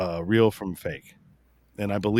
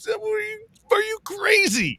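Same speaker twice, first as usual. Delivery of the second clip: incredulous shout. Retold or imitated. Telling off the liberal babysitter, a shrill incredulous shout. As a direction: shout it like she's lost her mind. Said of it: "And I'm like".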